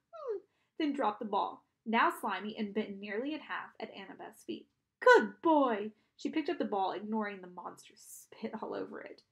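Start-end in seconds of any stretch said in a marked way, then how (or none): 5.0-5.9 loud dog-trainer praise; hearty good-dog voice; praising a dog. A narrator's reading.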